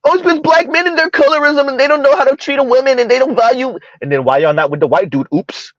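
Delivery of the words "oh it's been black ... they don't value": high, whiny mock voice of the women on the show; mocking women's claim. Vlogger mocking the women on the show, in a higher whiny voice. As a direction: parrot their complaint in a higher, put-on voice.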